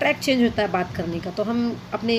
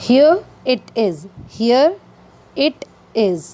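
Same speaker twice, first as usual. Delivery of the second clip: slow teaching demo; much slower than usual. Shown as a demonstration. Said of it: "तो English में हम कह सकते".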